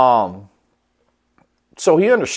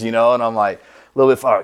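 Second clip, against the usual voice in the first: ordinary talk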